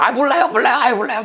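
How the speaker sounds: high, whiny sulking refusal; sulky whine. Acted. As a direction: pout it out like a sulking child. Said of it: "막 그랬겠죠". (토라져서 징징대는 목소리)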